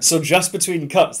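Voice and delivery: normal talking voice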